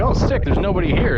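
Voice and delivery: excited. Retold or imitated. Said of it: "I'm like".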